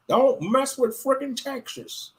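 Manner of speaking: drawn-out mock-tough Texan threat. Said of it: "them Gentiles told them Texas".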